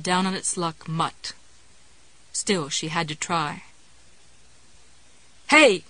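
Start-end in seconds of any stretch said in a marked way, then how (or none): 5.5-5.8 sharp scream for attention. A narrator's reading. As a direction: yell it out loud. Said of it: "she screamed".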